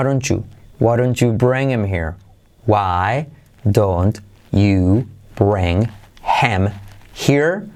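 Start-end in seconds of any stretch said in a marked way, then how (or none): 2.7-7.7 slow, separated words with every H sounded; careful textbook delivery, pauses between words; word-by-word version. Shown as a demonstration. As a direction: spell out WHY DON'T YOU BRING HIM HERE one word at a time. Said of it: "Pero dicho al estilo nativo".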